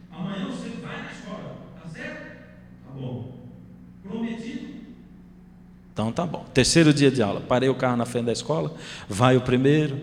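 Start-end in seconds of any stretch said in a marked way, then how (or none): 0.1-6.5 soft bedtime talk. Retold or imitated. Father softly tucks son into bed.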